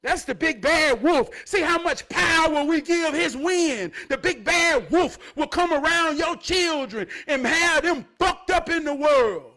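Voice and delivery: loud, rising preacher's fervor; preaching at full force. Acted. Preacher warning the flock about the wolf, fired up and loud. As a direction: preach it hard to the back row.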